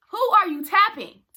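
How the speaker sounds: loud, high confrontational question; indignant challenge. Retold or imitated. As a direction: call them out loudly. Repeats the indignant challenge, louder.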